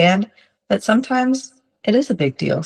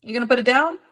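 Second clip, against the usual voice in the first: gentle high prompt; 7 semitones higher than usual. Retold or imitated. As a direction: coax the child kindly to put it down. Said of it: "you know, like".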